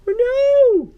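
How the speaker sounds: high, loud "oh no"; alarmed cry. Acted. Narrator seeing logs on the track, high alarmed cry.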